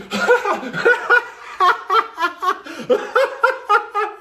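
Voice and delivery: wild, high-pitched fit of laughter; high hysterical laugh. Real, not acted. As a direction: lose it completely, laughing high. Friends laughing at fire pit.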